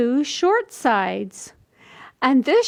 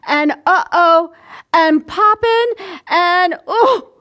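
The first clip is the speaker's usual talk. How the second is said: higher, louder uh-oh and poppin' cries; dramatic alarm; 4 semitones higher, 4 dB louder than usual. A narrator's reading. Reading the tumble aloud to children, higher and alarmed. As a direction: gasp out each tumble.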